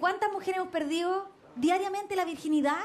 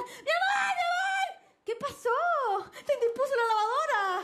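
high, shrill-pitched mock yell of a panicking husband; husband panicking over the red laundry, high mock yell; mock panicked yell; 8 semitones higher, slower than usual. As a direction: squeal it like a frantic husband. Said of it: "empezó a gritar".

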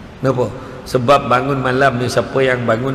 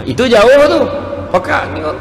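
usual speaking voice

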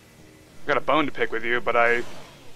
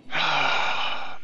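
long, breathy exhale; dejected sigh. Real, not acted. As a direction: sigh out the frustration. Dejected after losing to phantom.

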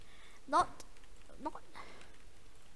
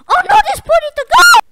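high shrieking panic; screaming; 18 dB louder than usual. Real, not acted. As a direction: shriek in alarm. Sudden danger in game.

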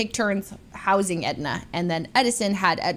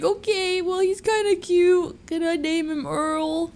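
softening up; 7 semitones higher, slower than usual. Retold or imitated. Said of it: "And then I was like".

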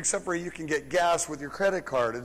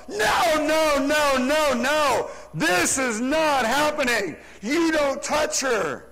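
loud, high, commanding shout; retold yell; 8 semitones higher, 5 dB louder, slower than usual. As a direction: yell across a parking lot to stop a fight. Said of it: "and I yell out".

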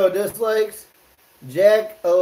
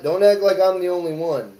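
usual speaking voice

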